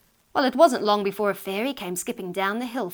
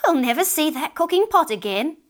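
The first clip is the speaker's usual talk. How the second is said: loud, high-pitched lament; crying out; 7 semitones higher, 6 dB louder than usual. A narrator's reading. Old woman lamenting her lost pot, crying out. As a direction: wail it as an exclamation. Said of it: "she cried".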